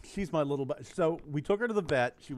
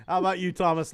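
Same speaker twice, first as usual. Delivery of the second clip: ordinary talk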